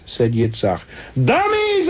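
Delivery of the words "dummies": shouting. A narrator's reading. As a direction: shout it out wildly. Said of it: "I shouted".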